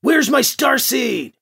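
high, drawn-out angry demand; barked demand. A narrator's reading. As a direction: bark the question slowly. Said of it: "Finder barked at her".

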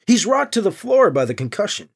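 ordinary delivery

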